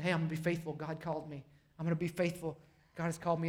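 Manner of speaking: low, subdued steady voice; quiet resolve. Acted. Noah answers the people laughing at him, quietly resolved. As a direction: hold firm softly, under the mockery.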